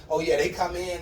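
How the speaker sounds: ordinary delivery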